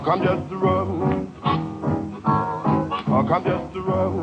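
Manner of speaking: normal talking voice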